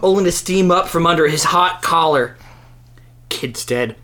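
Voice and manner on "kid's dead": quiet. Acted. Teacher reports dead kid.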